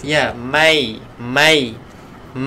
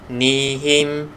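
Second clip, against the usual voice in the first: ordinary talk, nothing marked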